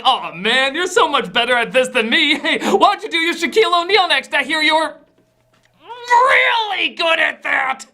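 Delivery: high, hammed-up admiration, slowing at the end; strained mock flattery. Actor praising the better impressionist beside him, strained mock flattery. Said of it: "He's just gotta sit there like".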